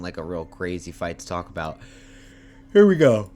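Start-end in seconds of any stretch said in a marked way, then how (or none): 2.7-3.3 yawning. Real, not acted. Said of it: "I'm sorry for yawning".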